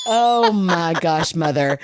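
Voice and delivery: exasperated mother. Retold or imitated. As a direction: stretch it out in disbelief. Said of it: "I'm like".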